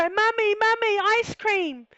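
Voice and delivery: put-on high, drawn-out child's plea of Mummy; little son pleading. Young son begging his mum for an ice cream, high and pleading. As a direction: beg like a small boy hearing the ice cream truck. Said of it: "He would go".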